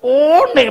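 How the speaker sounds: "One" as long, loud "ooo-neh"; exasperated misreading. Retold or imitated. Father losing temper over the reading, drawn-out "oh-neh". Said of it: "Emosi jiwa sebenarnya".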